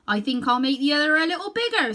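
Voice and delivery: a craftsman musing over his work; farmer's voice. A narrator's reading. Farmer tells the Munchkin, lightly voiced and pleased. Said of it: "said the farmer".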